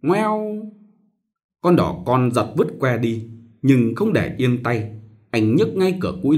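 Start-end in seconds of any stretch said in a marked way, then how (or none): 0.0-0.7 high hurt mewing; pained cat yowl. Acted. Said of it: "Nó đau nheo miệng lại kêu".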